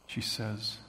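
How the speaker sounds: normal talking voice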